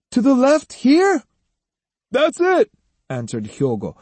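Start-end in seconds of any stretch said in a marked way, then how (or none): 0.1-1.2 loud high call back down the road; shouted from afar. A narrator's reading. Boy shouting back from the crossroads, loud call. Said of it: "he half turned and shouted". 2.1-2.7 loud high reply across a distance; calling back. A narrator's reading. Hyogo calling back to the distant boy, loud.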